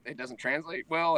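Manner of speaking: ordinary talk, nothing marked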